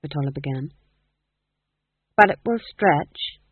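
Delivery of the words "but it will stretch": said firmly, louder and slowed; wife overruling her husband's worry, firmly. A narrator's reading. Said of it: "said his wife firmly".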